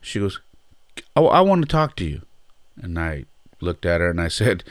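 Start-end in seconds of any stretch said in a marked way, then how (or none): 1.2-2.2 high, loud, eager call to get his attention; excited mom. Retold or imitated. Mom from the bleachers calls out to the coach, high and loud. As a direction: imitate a mom marching up to you, higher and louder.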